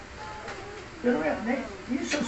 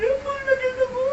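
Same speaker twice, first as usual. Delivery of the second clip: crying; 12 semitones higher than usual. Retold or imitated. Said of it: "umiyak ako".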